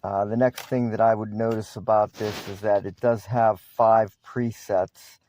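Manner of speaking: ordinary talk, nothing marked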